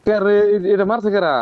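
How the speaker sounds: high, loud alarmed call; alarmed shout. Real, not acted. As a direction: shout who shot us.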